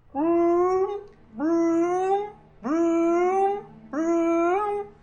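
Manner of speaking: loud high engine vroom; airplane spoon game. Acted. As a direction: vroom it like an approaching craft. GM voicing approaching aircraft.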